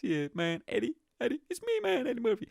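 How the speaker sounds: very high comedian impression; squeaky-high attempt at a star's voice; Eddie Murphy. Shown as a demonstration. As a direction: mimic a famous comic, pitch leaping up. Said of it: "He has that voice".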